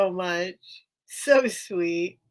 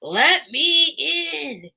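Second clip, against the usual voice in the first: slow whiny cat voice; high drawn-out pet voice; voicing the cat; 6 semitones higher, 4 dB louder, much slower than usual.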